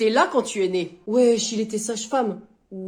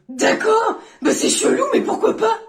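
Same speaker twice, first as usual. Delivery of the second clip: much higher, loud imitation; mimicking the boy; 10 semitones higher, 5 dB louder than usual. Friend retelling the boy's answer to the plan, mimicking. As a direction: imitate a puzzled but willing reply, pitched up.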